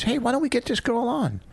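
a high, breezy take on Bonnie; high mock Bonnie pitch; imitating Bonnie. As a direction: toss off the suggestion like Bonnie would. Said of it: "and Bonnie goes".

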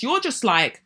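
ordinary talk

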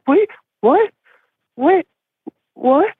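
high, bewildered double take; startled confusion. Retold or imitated. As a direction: sound suddenly lost and alarmed. Boyfriend suddenly realizing something's wrong.

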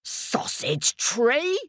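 slow, high-rising incredulous question; hammed-up astonished king, ending high; bellowing king. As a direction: echo it in drawn-out royal disbelief. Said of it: "bellowed the king".

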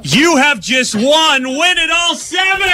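big, slow, high excited shout; excited announcement. Real, not acted. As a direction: announce the win with full excitement. Surprising a contest winner at door.

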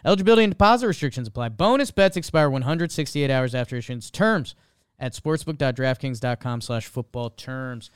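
rapid-fire fine print; fast legal disclaimer. Acted. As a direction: rattle off the small print.

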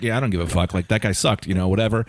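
low, offhand guard voice; indifferent security guard. Retold or imitated. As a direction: lightly imitate a guard shrugging it off. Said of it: "the security guard is like".

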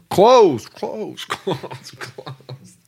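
playful echo dying away to nothing; fading echo. Pretend. Cohost playing with the word close, fading echo.

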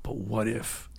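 faint, far-off whisper of doubt; hushed inner voice asking what if. Retold or imitated. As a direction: let the little voice creep in, low and soft. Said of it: "the little voice inside going".

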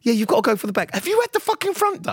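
high, incredulous mock outrage; retold interrogation. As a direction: replay the grilling, pitch climbing sharply. Retold interrogation over hair transplant.

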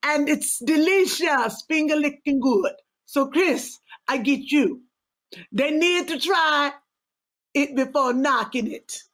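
put-on Caribbean island accent, drawn out in places. Co-host finishing the viewer's dumpling comment, Caribbean accent. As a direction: savor it in a Caribbean lilt. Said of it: "if I read that in that accent".

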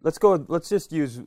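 ordinary talk

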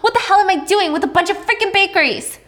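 furious daughter yelling, pitch climbing; yelling at mom. Retold or imitated. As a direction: rail at your mother, fast and loud. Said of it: "she's yelling at her mom".

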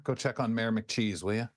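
ordinary delivery

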